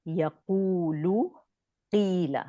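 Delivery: یقولو slow with a two-count vowel; lightly stretched vowels. Shown as a demonstration. Teacher showing madd in یقولو and قیلا. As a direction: stretch the long vowels in یقولو just a little. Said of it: "اسے تھوڑا تھوڑا".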